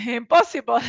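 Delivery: real laugh around the word; amused, laughing protest. Real, not acted. Put on the spot for advice.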